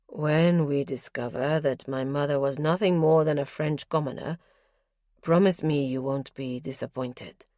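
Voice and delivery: a lightly deepened nobleman reporting calmly; lightly voiced lord, measured and guarded; Justin's voice. Justin's voice in dialogue.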